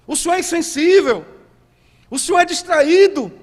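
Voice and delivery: high, loud, indignant protest to God; audacious complaint. Acted. Fasting people complaining that God does not respond, audacious outcry. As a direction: cry out the grievance at full pitch. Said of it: "eles têm a audácia de dizer para Deus".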